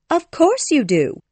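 put-on high, smiling girl's voice; Wendy. A narrator's reading. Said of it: "Wendy smiled".

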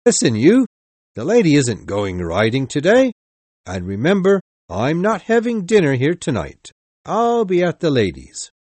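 calling out high and loud, showing off; loud call to the waiter. Acted. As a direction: bellow it across the room so he hears. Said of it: "called out to the waiter, loud enough so I could hear".

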